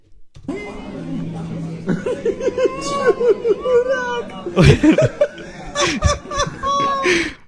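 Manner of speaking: very high, loud real shriek of laughter. Real, not acted. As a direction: lose it completely. Laughing at a video.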